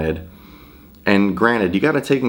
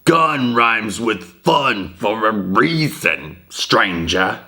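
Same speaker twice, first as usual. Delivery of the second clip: slow, mocking cheap knockoff Merchant voice; mocking the remake Merchant's corny line, slow and put-on; new Merchant impression; 3 semitones higher, much slower than usual. Hammed-up knockoff shopkeeper, drawn out. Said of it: "than just saying".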